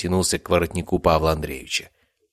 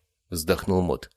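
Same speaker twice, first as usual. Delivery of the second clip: usual speaking voice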